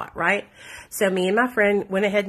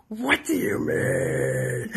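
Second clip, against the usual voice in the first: very drawn-out, strained disbelief; hammed-up wail of protest, stretched right out; retold freak-out; 14 semitones lower, 3 dB quieter, much slower than usual. Daughter refused car money by dad, hammed-up drawn-out freak-out. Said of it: "I'm freaking out".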